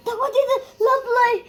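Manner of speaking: scared laughing cry. Pretend. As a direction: call out the ghost with nervous giggles. Kids playing ghost hunt. (भीतीने हसत ओरडणे)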